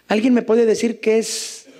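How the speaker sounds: ordinary talk, nothing marked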